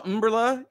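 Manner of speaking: "umbrella" said as UM-brella; "umbrella" with its weight moved to the front; first-syllable stress. Shown as a demonstration. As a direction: hit the opening UM of "umbrella". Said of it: "pronounce umbrella that way because umbrella sounds exotic".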